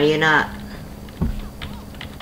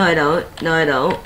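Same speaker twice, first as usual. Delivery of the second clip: usual speaking voice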